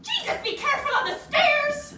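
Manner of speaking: very high, loud acted panic; alarmed shout. Parent visiting the flat, high panicked shout. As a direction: shriek it as a hammed-up warning.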